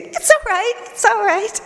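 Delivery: very high, squeaky, forced calm; a terrified guide pretending all is fine; high, squeaky tour guide among cannibals; panicked tour guide. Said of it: "I sounded like a tour guide in the middle of the jungle".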